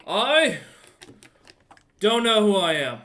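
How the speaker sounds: lost and dazed, asking for help; confused half-orc. Acted. Naked half-orc waking lost among the carnage, bewildered. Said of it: "the man looks at both ... and goes".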